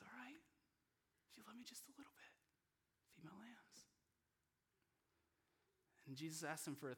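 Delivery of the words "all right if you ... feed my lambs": very soft, higher, near-whispered reassurance; gentle acceptance. Acted. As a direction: accept him tenderly, in a hush. Jesus gently accepting Peter's love.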